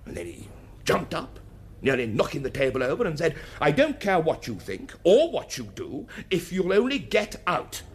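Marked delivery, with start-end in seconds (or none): 3.6-7.8 mildly heated, higher-pitched dismissal; quoting an exasperated order to leave; retold angry outburst. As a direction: act out a man losing patience in a quarrel. Retold quarrel, jumped up.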